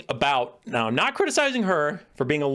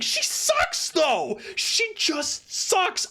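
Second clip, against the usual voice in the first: scornful squeal; much slower than usual. Retold or imitated. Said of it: "And I'm like".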